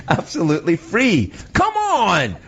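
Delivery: high, exuberant sales-pitch outburst; incredulous high-pitched cry; hammed-up exclamation.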